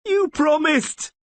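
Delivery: high, loud, slow anguished plea; desperate cry. A narrator's reading. Vilis begging Rayla to keep her promise. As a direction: cry it out while dying.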